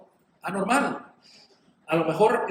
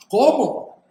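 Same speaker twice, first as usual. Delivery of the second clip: high, loud shocked question; indignant mock disbelief; acted outrage; 6 semitones higher, 9 dB louder than usual. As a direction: burst out as if you can't believe the refusal. Acting the shocked manipulator's reaction.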